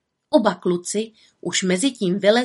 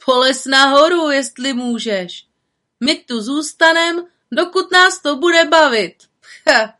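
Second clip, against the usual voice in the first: louder, higher mocking boy; cheeky taunt; 5 semitones higher, 5 dB louder than usual. A boy in the tree taunting the little witch, mocking. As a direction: jeer at the girl. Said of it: "jeden drze odpověděl".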